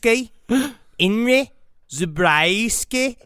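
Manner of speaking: name said in a put-on Australian accent; Australian self-introduction. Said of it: "I'm gonna try ... Australian".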